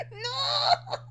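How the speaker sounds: high, laughing no with a sob in it; laughing anguished cry. Real, not acted. Fan reacting to a heartbreaking anime goodbye, laughing cry. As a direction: cry out half laughing, half hurt.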